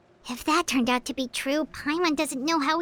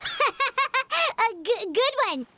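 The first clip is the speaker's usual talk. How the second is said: laughing it off, higher; nervous laugh; 8 semitones higher, much slower than usual. Acted. Paimon laughing off the Knave's accusation. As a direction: cover being caught with a laugh.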